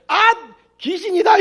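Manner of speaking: high, loud, slow terrified shriek; ghost scream. Pretend. Worshippers seeing a dead man appear, terrified shriek. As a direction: shriek as if you've seen a ghost.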